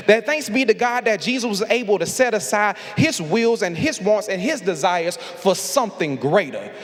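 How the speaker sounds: rising preacher cadence, building fervor; Sunday sermon swell; preaching. Acted. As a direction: preach it, climbing toward the call.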